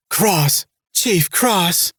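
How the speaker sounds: high, panicked shriek of his name; terrified screaming. A narrator's reading. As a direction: scream it in blind terror. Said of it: "She stopped screaming".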